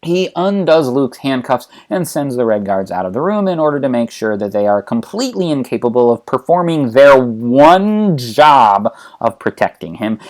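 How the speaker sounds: ordinary talk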